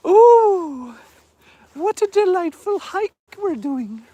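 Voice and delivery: high, slow put-on posh voice; a hammed-up refined lady admiring the view; mock posh delight. Streamer mocking the hike in a posh voice.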